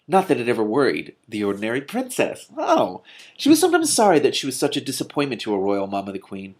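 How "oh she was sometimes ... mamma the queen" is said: plain reading voice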